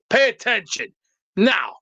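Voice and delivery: ordinary talk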